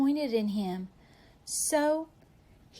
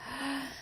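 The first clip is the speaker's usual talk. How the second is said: gasp; 4 semitones higher, 8 dB quieter than usual. A narrator's reading. Gasp before reveal.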